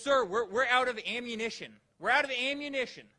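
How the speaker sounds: loud, urgent general reporting bad news; alarmed general. General warning the new president, loud and urgent. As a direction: blurt it out like a worried officer. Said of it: "He goes".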